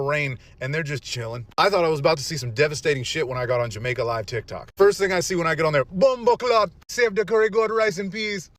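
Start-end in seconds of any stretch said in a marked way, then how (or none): 5.9-8.5 imitating a Jamaican patois exclamation, pitched higher; a playful take on a Jamaican accent; mock Jamaican accent. TikTok viewer imitates a Jamaican livestreamer, in a mock Jamaican accent. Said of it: "full-on Jamaican responses".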